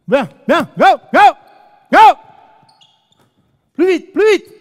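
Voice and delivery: loud, high "go" and "plus vite"; excited shouting. Real, not acted.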